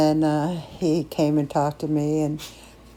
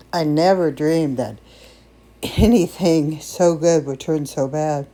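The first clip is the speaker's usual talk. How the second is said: ordinary talk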